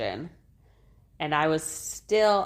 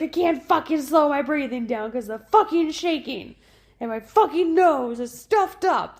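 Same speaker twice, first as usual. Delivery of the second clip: much higher, louder furious rant; mad inner rant; 10 semitones higher, 6 dB louder than usual. Retold or imitated. Mother recalling her panic after birth, high furious rant. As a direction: vent furiously at your own shaking body. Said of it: "I was so mad in that moment".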